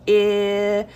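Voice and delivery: I as a sustained "ihhh"; isolated vowel sound. Shown as a demonstration. Teacher confirming the first sound, I held as "ihhh". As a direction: hold I on one note, as in igloo. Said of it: "The first sound is".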